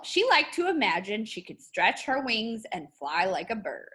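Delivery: lively picture-book read-aloud with rising lines; reading to children. Acted. Teacher reading a picture book to her class, lively storytelling voice. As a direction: tell it like a bedtime story for young kids.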